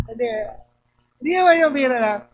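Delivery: usual speaking voice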